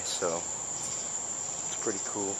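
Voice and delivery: slow hushed whisper. Real, not acted. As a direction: breathe it out softly. Whispered aside.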